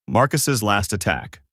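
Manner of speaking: ordinary delivery, nothing marked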